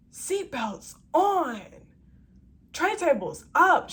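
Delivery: loud barking sergeant order, high and slow; sergeant yell. Retold or imitated. Flight attendant yelling orders at sleeping passengers, loud sergeant bark. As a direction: bellow it like a drill sergeant down the aisle. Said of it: "she sound like a sergeant".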